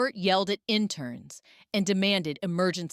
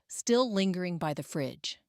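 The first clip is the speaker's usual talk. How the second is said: ordinary delivery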